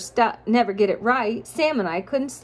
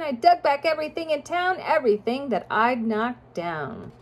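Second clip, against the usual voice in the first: sing-song rhyming storybook read; reading to children; 5 semitones higher than usual. A narrator's reading. Parent reading a rhyming picture book to a child, sing-song. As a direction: lilt the rhymes for a child listener.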